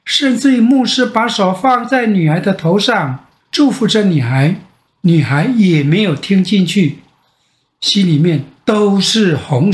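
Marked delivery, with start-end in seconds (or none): none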